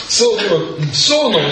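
ordinary talk

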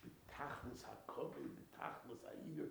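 ordinary talk, nothing marked

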